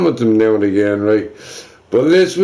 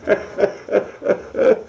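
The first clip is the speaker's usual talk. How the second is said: breathy laugh; 6 semitones higher, 4 dB quieter than usual. Real, not acted. Laughs at himself.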